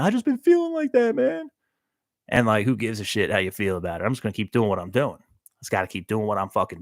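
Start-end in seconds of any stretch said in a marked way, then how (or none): 0.0-1.5 much higher, crooned falsetto; playful high croon. Pretend. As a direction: float it up high like a lazy tune. Sings his own feeling.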